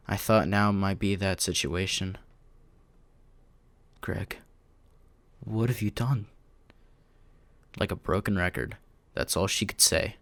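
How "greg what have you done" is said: softly stunned, starting under the breath; hushed disbelief. A narrator's reading. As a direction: say it quietly, barely getting it out. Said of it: "in a hushed voice".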